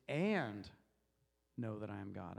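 "and" punched and held, then a low soft finish; stress on and. Shown as a demonstration. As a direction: isolate "and" before a pause. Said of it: "I'm gonna go to the next word".

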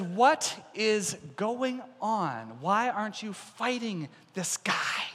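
very slow, word by word; drawn-out, baffled demand; incredulous challenge. Acted. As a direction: space out each word in disbelief. David challenges the frightened army.

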